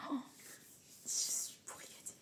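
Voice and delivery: breathless awed whisper. Real, not acted. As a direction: whisper it to yourself, overwhelmed. Whispered aside after unwrapping. (chuchoté, ému)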